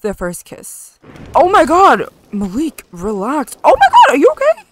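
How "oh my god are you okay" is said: much higher, louder, startled cry; alarmed squeal. Real, not acted. Gamer shrieking in alarm at her sim. Sim suddenly reacts badly.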